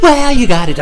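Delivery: high, loud goofy outburst; put-on high, loud voice; goofy catchphrase voice. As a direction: blurt it like a cartoon catchphrase. Running catchphrase bit in put-on voice.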